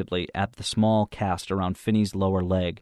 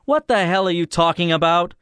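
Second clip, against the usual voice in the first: loud, high-pitched indignant outburst; sudden angry interruption; 10 semitones higher, 7 dB louder than usual. A narrator's reading. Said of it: "I cut in suddenly".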